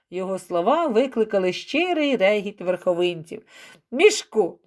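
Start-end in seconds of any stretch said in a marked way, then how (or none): none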